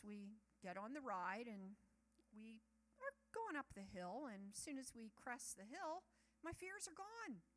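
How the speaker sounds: ordinary delivery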